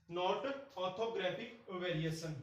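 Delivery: very slow, word-by-word dictation pace. Shown as a demonstration. Teacher dictating a note to students, very slowly. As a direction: read it out slowly so the students can copy it down.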